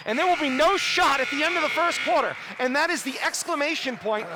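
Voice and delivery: loud, high end-of-quarter call; excited call. Real, not acted. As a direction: call the buzzer with energy.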